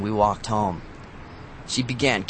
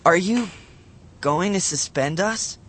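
higher and hesitant, slowing at the end; trembling voice; 6 semitones higher than usual. A narrator's reading. Matt fearing suspension, trembling. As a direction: ask it scared, voice wobbling. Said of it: "I asked in a trembling voice".

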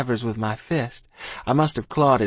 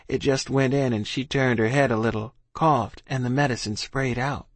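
usual speaking voice

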